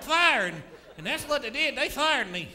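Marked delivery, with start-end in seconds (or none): 0.0-0.5 hollered. Retold or imitated. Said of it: "I hollered".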